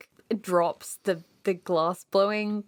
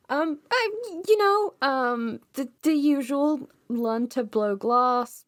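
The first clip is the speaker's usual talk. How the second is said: higher, halting, hesitant delivery; awkward; 6 semitones higher, 3 dB louder than usual. Acted. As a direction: stall awkwardly through small talk with an old friend. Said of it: "really awkwardly, like, twirling the hair".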